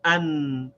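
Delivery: "an" said clipped, stopping dead on the n; "an" as one closed syllable; demo syllable an. Shown as a demonstration. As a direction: clear teaching demo of "an". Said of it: "we read them together as".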